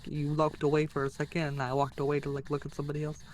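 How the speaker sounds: ordinary talk, nothing marked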